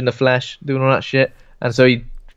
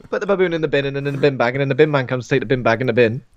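auctioneer patter; faster than usual. Pretend. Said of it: "You sound like, um, like an auctioneer".

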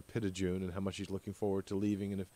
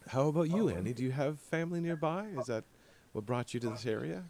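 higher, bright curious questions; friendly Joan; 5 semitones higher, faster than usual. Acted. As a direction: turn to the newcomer with eager interest. GM voices Joan turning to Annie.

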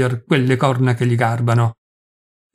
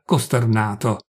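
ordinary delivery, nothing marked

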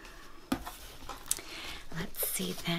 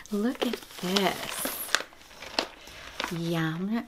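ordinary talk, nothing marked

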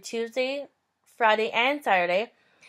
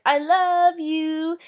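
slow, higher drawn-out call across the camp; mock drunk shout; 7 semitones higher, 3 dB louder, much slower than usual. Drunk guy shouting his love across the campsite, hammed up. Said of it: "the guy shouts to me".

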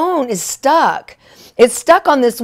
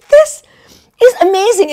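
high, loud burst of delight; thrilled; 8 semitones higher, 6 dB louder than usual. Retold or imitated. Reacting to the phone stuck again, high excited burst. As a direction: squeal it with amazed joy.

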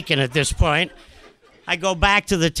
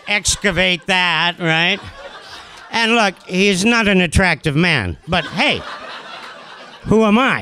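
ordinary delivery

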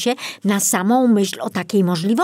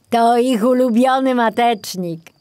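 put-on old forester voice; 6 semitones higher, 5 dB louder than usual. Old forester Kobiela speaking.

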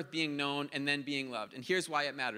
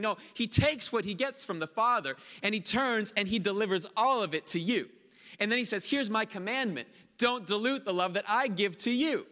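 higher, louder preaching that drives each line home; full-force preaching; 6 semitones higher, 4 dB louder than usual. Acted. Pastor preaching hard to the congregation about God's love. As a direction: preach it out hard to the whole room.

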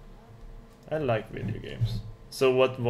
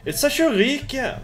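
loud, high excited yell; excited shout; 13 semitones higher, 6 dB louder than usual. Real, not acted. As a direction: shout it out with glee.

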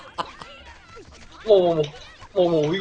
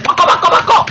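high, loud frantic command; urgent shout; 10 semitones higher, 6 dB louder than usual. Real, not acted. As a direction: shout urgently to your teammate, pitched high. Urgent call to switch mid-race. (다급하게 높이 외치기)

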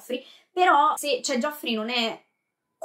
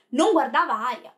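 usual speaking voice

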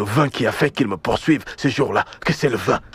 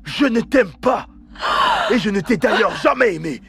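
angry rejection; 8 semitones higher than usual. Acted. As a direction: lean hard on each word of Je ne t'aime pas. Repeated rejection, angrier.

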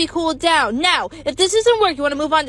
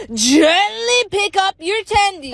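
stretched-out, higher "gently"; slow emphatic instruction; 4 semitones higher, 4 dB louder, slower than usual. Acted. Host teaching how to cool a tendy, slow and emphatic. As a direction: draw it out with mock care. Mock tutorial, exaggerated careful instruction.

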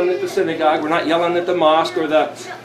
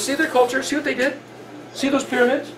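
high, soft pointing-out questions; lightly acted guide on a tour; sightseeing guide; 6 semitones higher, 6 dB quieter than usual. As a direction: point out the sights in a hushed, higher voice. Acts Yahweh pointing out sights.

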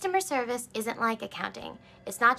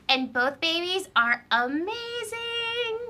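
gushing; 7 semitones higher, much slower than usual. Real, not acted. Gushing celebrity baby news.

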